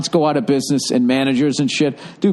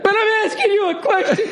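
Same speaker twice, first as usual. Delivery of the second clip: high laughing blubber; imitating crying. Owner to Gordon after hearing his dad died, mock sob. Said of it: "he fucking starts crying".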